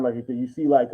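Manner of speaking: ordinary talk, nothing marked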